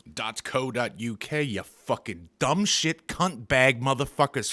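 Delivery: ordinary talk, nothing marked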